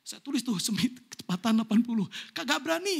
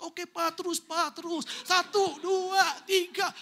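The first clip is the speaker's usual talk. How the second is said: put-on higher, bouncy children counting cars; excited kids counting; 6 semitones higher, slower than usual. Pastor imitating his kids in the back seat, higher and bouncy. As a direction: play the kids cheering dad on. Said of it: "Mereka loncat-loncat".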